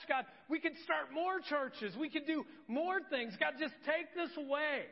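desperate begging. Retold or imitated. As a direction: beg God with everything you've got.

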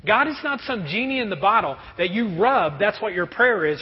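ordinary delivery

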